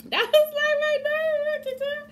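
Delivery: a relieved high-pitched squeal. Real, not acted.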